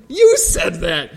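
high, slow, laughing accusation; mock accusation. Pastor blames the reporter for the quote, mock accusing. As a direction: throw the blame back, half laughing. Throws misquote back at reporter.

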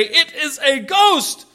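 high, slow frightened cry of ghost; preacher acting out panicked disciples; terrified shout. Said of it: "they're terrified and shout out because they say".

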